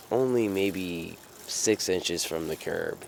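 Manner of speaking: ordinary talk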